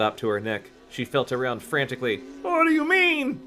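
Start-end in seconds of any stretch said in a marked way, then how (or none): 2.4-3.4 very high frightened question; high panicked voice. Acted. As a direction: squeak it in panic. Carly Beth voiced high.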